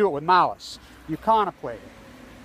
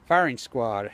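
ordinary talk, nothing marked

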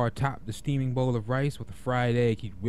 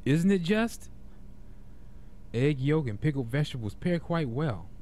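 cheerful; 5 semitones higher than usual. Acted. Cook pleased with his dish.

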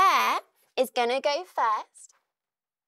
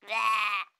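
a yucky spit-out bleh; grossed-out bleh at the slimy sandwich; disgusted bleh. Shown as a demonstration. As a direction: pull a face at the slime taste. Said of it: "give it a bit of a taste".

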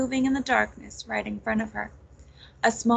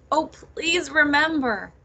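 drawn-out, higher, louder plea; pleading; 6 semitones higher, 5 dB louder, slower than usual. A narrator's reading. Nettle begs Dee and Anna to remember, pleading. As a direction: beg slowly, stretching the words out. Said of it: "pleaded Nettle".

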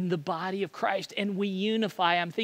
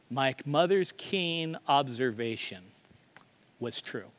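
normal talking voice

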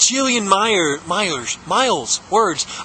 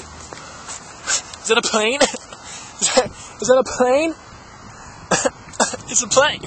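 laughing; 5 semitones higher, 3 dB quieter, slower than usual. Real, not acted. Giggling over a plane sighting.

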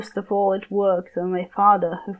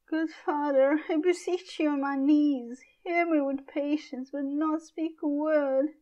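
higher, softer tearful plea; Juliet begging; 7 semitones higher, 8 dB quieter than usual. Acted. Daughter begging her raging father, higher tearful plea. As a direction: beg on your knees, near tears.

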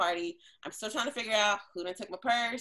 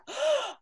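imitated gasp. Girl reacts to being smacked.

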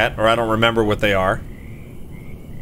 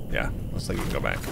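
whisper; 9 semitones lower, 10 dB quieter, faster than usual. Pretend. Answering himself aside.

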